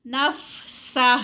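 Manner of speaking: نفسہ drawn out very slowly with a breathy ہ at the end; نفسہ recited slowly with a final ہ; recitation demo. Shown as a demonstration. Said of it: "اینڈ پہ ہا کی آواز آئے گی".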